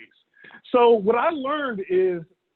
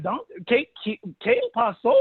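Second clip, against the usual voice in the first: confused waiter; 6 semitones higher than usual. Retold or imitated. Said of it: "And they're like".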